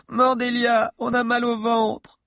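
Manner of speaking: high, slow whine on one note; children's wailing. A narrator's reading. Children complaining to their leader of hunger, wailing. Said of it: "pleuraient plusieurs enfants".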